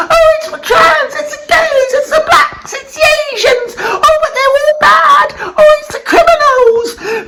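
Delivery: high, mocking whine of people blaming groups; mocking blamers. Ranting host mocks people who blame minorities, high whiny mock voice. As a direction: lampoon the blame-everyone crowd in a high put-on voice. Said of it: "we are all ... attacking each other".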